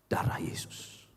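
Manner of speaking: whispered reverence; hushed whisper. Real, not acted. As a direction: breathe it out in a whisper. Climax of grace point.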